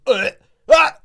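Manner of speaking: loud disgusted retch; re-enacted choking yelp at a stink; mock gagging cry. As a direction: act out a recoil from a stench. Reacting to stench in pig shed.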